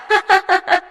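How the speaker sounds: put-on high, scornful girlish laugh; mocking laugh. A bully laughing at a classmate, mocking. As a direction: laugh in her face.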